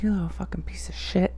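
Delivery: furious hushed curse; seething unvoiced whisper; angry whisper. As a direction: spit the curse under your breath. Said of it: "I'm like".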